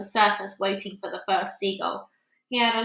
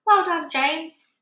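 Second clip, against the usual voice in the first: hearty praise; 7 semitones higher, 5 dB louder, slower than usual. A narrator's reading. Grasshopper praises James.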